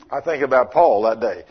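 ordinary talk, nothing marked

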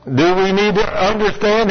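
usual speaking voice

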